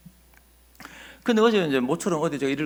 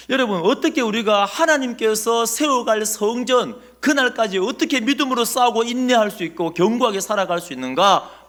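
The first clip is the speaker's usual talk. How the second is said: loud, emphatic sermon delivery, raised pitch; full-force preaching; 7 semitones higher, 7 dB louder than usual. Acted. Pastor urging the congregation to remember Nehemiah, preaching hard. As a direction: drive the message home to the congregation.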